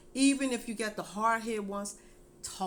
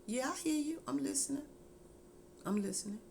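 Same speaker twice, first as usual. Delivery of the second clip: put-on teen answering; 7 semitones higher, 4 dB quieter than usual. Child answers parent.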